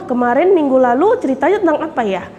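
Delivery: ordinary talk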